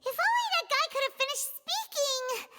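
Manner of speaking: frustrated. Acted. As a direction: lament a near miss in frustration. Frustrated at interrupted confession.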